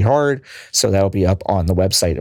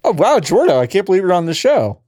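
higher, put-on amazement; mock surprise; 8 semitones higher than usual. Host greeting the producer's surprise appearance, mock amazed. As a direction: play along as if astonished.

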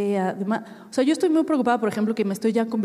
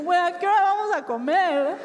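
hungry guest; 8 semitones higher than usual. Retold or imitated. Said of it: "es como".

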